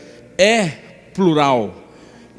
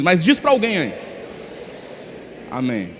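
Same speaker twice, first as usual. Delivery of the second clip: normal talking voice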